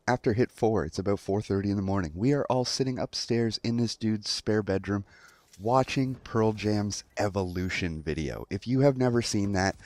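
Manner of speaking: usual speaking voice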